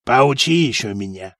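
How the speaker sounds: low, scornful retort; spat retort. A narrator's reading. Yusuf at the helm to Stefan, spitting scorn. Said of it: "сплюнул Юсуф".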